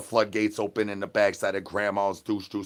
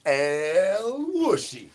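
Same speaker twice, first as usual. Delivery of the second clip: crooning call in a strange voice; high, slow put-on voice; 7 semitones higher, much slower than usual. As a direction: stretch El Wushi out slowly and high. Repeated character chant.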